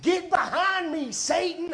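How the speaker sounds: shouted high and loud rebuke; mock rebuke. Pastor retells his panicked yell at the joint, high loud shout. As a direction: yell it like casting out the devil. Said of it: "And I was like".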